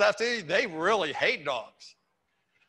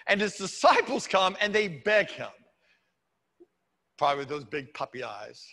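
usual speaking voice

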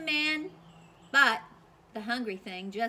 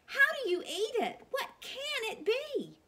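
much higher, astonished squeal; puzzled cry. Acted. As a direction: cry out in baffled wonder. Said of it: "cried the townspeople".

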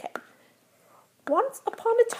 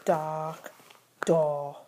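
hushed spooky reading; 6 semitones lower, 8 dB quieter than usual. Acted.

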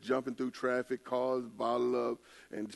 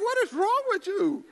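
shocked disbelief; 7 dB louder, slower than usual. Retold or imitated. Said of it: "I'm like".

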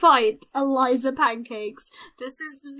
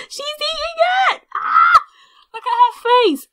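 very high-pitched excited yelling; loud squealing reaction; shocked shriek; 13 semitones higher, 8 dB louder than usual. Real, not acted. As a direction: shriek it in disbelief. Shrieking at game event.

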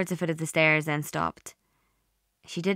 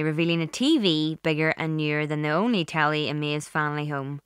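usual speaking voice

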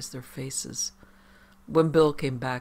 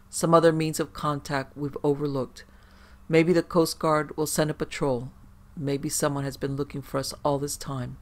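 ordinary delivery, nothing marked

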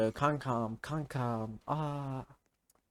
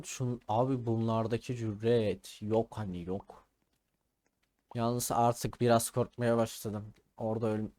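ordinary delivery

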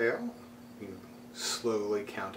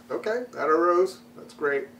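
higher, louder mock self-talk; casual acceptance; 8 semitones higher, 7 dB louder than usual. Meditator telling himself how to meet a distraction, casual acceptance. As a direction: brightly acknowledge it and let it go.

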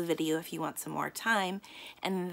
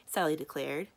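ordinary delivery

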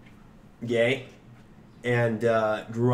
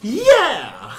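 loud, high-pitched hyped yell; excited cheer; 10 semitones higher, 9 dB louder than usual. Real, not acted. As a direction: whoop it out, drawn out and loud. Hyped cheer before smoking.

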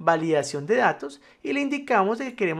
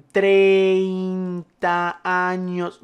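hugely stretched, louder syllables; typing aloud; 6 dB louder, much slower than usual. Shown as a demonstration. Teacher showing how people wrongly enter age, drawn-out typing voice. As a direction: drag each syllable out as if slowly writing it.

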